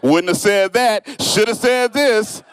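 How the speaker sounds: slow, prissy corrector voice; lightly mock a pedantic editor; mocking know-it-all.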